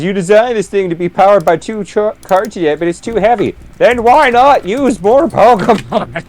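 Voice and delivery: mock exasperated question. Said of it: "I also love this".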